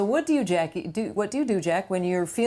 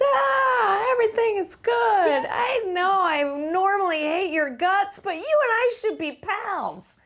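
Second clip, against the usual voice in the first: put-on much higher, gushing drunk buddy voice; happy drunk; 12 semitones higher than usual. Happy drunk telling an enemy they're pals, high gushing slur. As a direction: play a cheerful drunk who loves everyone, pitched way up.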